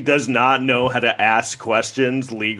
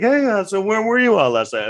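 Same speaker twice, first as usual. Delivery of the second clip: higher, lightly voiced girlfriend; mock probing question in a raised pitch; 9 semitones higher than usual. Girlfriend asking where he was last night, mock probing. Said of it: "you come home to your girlfriend's place".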